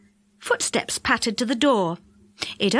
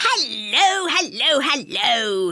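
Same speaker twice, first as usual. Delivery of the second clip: beaming Moonface; slower than usual. A narrator's reading.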